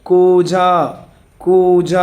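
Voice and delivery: కూజ called out loud, with the కూ held long; loud vowel demo. Shown as a demonstration. Teacher to pupils calling out కూజ to show the long ఊ. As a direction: belt out కూజ and hold the long ఊ. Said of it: "కొమ్ము దీర్ఘము ఎలా ఉచ్చరిస్తున్నా గమనించాలి".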